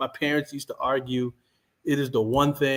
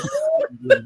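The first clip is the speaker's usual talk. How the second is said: squealing high laugh; high burst of laughter; 5 dB louder than usual. Real, not acted. Friend jokes about the blessing, high squealing laugh.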